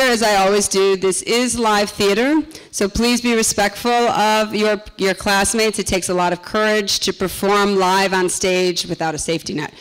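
normal talking voice

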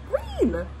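green sung out high and loud; big reveal. A narrator's reading. Reading to children, revealing the new colour high and loud. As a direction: pop the word green up high like a surprise.

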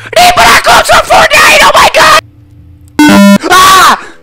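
high, loud panicked shriek; panicking gamer. Real, not acted. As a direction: scream in sudden gamer panic. Taken to back rooms, panicking.

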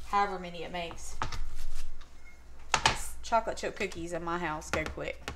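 normal talking voice

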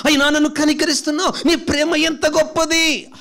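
a confessing believer building from a whisper to a fervent plea; repentant prayer, rising to a cry. Pretend.

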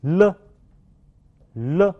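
"了" as a voiced l, tongue tip up; "了" with rising pitch, for learners to echo; model consonant sound. Shown as a demonstration. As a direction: say "了" alone, pause, repeat it. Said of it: "请大家跟我读".

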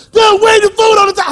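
high, loud mock shout; excited woman's outburst. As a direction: yell it like someone wildly worked up. Acting out excited woman's panic.

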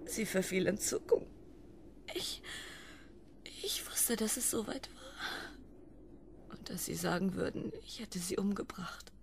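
hushed, haunted whisper; whispered confession. Acted. Wife whispering her childhood guilt to husband. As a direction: whisper the memory like a secret.

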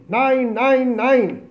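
high, loud cries of no; retold screaming. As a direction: scream it out in panic. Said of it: "און געשרייען". (געשריי)

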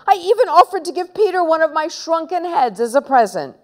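fast, high self-defence; protesting innocence. Acted. Boy offering mum his excuse, fast high protest. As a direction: rattle off the excuse high and quick.

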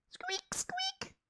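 tiny high whispered squeaks; mouse squeak. Acted. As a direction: squeak softly like a little mouse. Teacher imitates a mouse.

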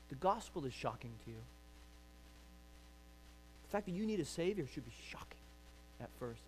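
quiet, fast, confiding hush. Acted. Preacher leaning in to the congregation, hushed whisper. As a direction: drop to a near whisper.

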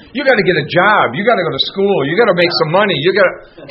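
mock the family pushing you back to normal life; family lecturing a newly sober man, mock nagging. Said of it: "Your family will look at you and they say".

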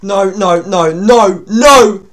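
building from scared to shouting; rising terrified protest; panicked no. Real, not acted. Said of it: "I'm not dealing with that".

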